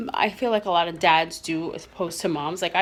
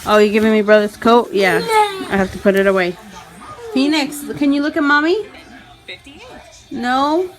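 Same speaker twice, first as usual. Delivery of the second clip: much higher and louder sing-song praise; talking to a toddler; 6 semitones higher, 10 dB louder than usual. Acted. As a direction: gush over the little boy's new haircut. Praises son's haircut.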